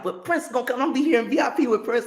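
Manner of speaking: high fast protest; pleading self. Retold or imitated. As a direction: insist Prince is coming. Teller's past self protesting.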